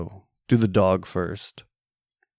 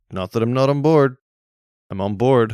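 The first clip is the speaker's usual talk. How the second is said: eager reassurance; 5 semitones higher, 8 dB louder than usual. Real, not acted.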